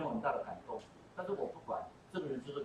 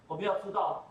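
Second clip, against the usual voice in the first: ordinary talk, nothing marked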